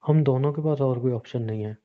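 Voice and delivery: ordinary talk, nothing marked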